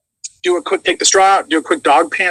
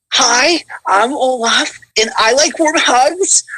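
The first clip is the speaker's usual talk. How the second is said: higher, slow, sweet snowman voice; Olaf voice; 8 semitones higher, much slower than usual. Pretend. Actor voices Olaf on the guest's request. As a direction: go wide-eyed and cheerful like Olaf. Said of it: "Talk to me like Olaf".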